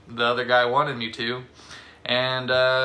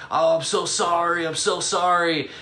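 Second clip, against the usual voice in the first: higher, gushing fake sorry; mock apology; 6 semitones higher than usual. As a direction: overdo the sorry, pitched up. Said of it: "changed his tone to".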